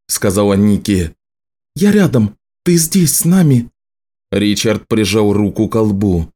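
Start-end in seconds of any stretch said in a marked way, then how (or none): 1.7-3.7 high, gentle comforting voice; reassuring. Acted. Nicci comforts Richard.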